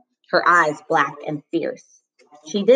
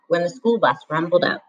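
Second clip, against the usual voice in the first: usual speaking voice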